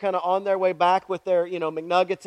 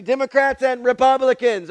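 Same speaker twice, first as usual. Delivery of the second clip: ordinary talk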